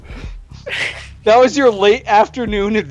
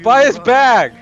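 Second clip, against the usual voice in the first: higher-pitched hawker's yell, held back; mock screaming vendor; 6 semitones higher, slower than usual. Old bag seller to random people, mock screaming. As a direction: pester passers-by with a half-shouted pitch. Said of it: "He's just screaming at random people".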